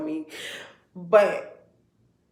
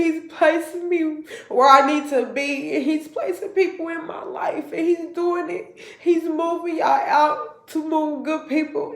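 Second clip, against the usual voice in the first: louder, higher, rising into a sung praise; preaching praise; 4 semitones higher, 3 dB louder than usual. Real, not acted. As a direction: testify like in church, lifting God up. Praising God.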